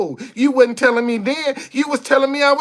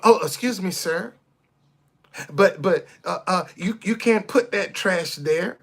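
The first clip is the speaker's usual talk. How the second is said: soft, hesitant, overly polite voice, much lower; a meek stammering plea to a litterer; mock timid politeness; 5 semitones lower, 6 dB quieter than usual. Polite stammering to a man who dropped trash, soft and hesitant. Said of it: "you supposed to be dressing up".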